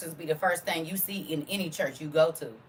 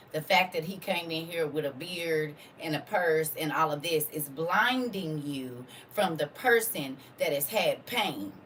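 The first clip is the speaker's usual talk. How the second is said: usual speaking voice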